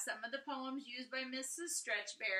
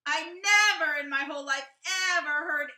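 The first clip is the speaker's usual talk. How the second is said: louder, eager burst of amazement; excited; 7 semitones higher, 13 dB louder, faster than usual. A narrator's reading. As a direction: let it burst out like a thrilled kid. Boy's amazed diary entry.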